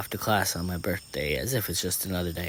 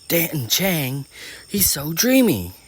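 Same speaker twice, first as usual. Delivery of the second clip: high, loud lovestruck gushing; swooning inner voice; 9 semitones higher, 7 dB louder, slower than usual. A narrator's reading. Girl's inner voice on seeing her crush, swooning. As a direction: gush over a crush, drawing out the end. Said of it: "my inner voice says".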